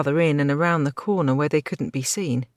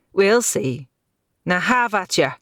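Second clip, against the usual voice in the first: battle call; 4 semitones higher, 6 dB louder than usual. A narrator's reading. Hero charges into battle.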